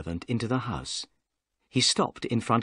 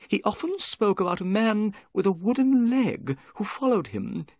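high-pitched little man telling his tale; nervous little man; 8 semitones higher than usual. A narrator's reading. Little man tells guests about his father, nervous. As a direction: keep a high character voice through the story. Figure of Thaddeus Sholto.

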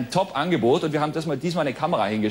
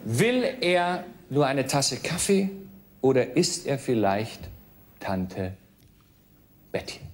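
quiz show host; 6 semitones lower, 4 dB quieter, slower than usual. Acted. Quizmaster reads question.

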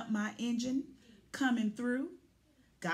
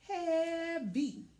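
higher, strained grunt of effort; straining under weight; 8 semitones higher, slower than usual. Acted. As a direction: groan as if lifting. Shoveling gravel into truck.

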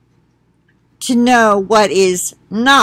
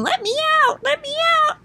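tiny bird voice; 3 dB quieter, much faster than usual. Retold or imitated. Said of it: "it was like it was saying".

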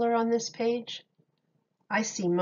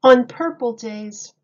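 lively picture-book read-aloud with big openings; reading to children; 5 dB louder than usual. Acted. Miss Julie reading Dr. Seuss to children, animated storytime voice. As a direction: read it like storytime for little kids.

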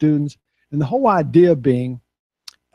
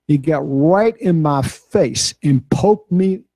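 ordinary talk

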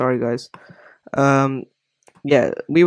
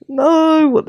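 high dismayed yelp; 6 dB louder than usual. Real, not acted. Panicking at broken image.

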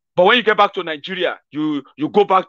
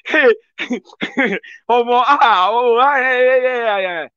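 mock laughing; 6 semitones higher, slower than usual. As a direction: pretend to burst out laughing with whoops. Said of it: "you started laughing like".

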